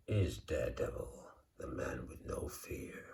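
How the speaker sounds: hushed, low American movie-trailer whisper; American accent. Pretend. Introducing Daredevil to viewers in a whispered American accent. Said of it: "Don't know why I'm doing an American accent".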